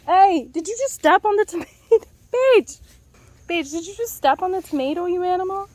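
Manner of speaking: high, loud mock-outraged scolding; scolding the dog. Real, not acted. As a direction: call the dog out, half joking. Scolding the dog over tomato.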